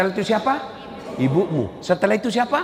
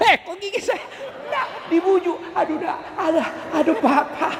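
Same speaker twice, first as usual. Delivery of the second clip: mock mother in pain; 11 semitones higher than usual. Nursing mother bitten by teeth.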